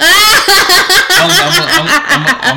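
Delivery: high burst of laughter; laughing. Real, not acted. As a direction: break up laughing mid-word. Laughing at friend's plug remark.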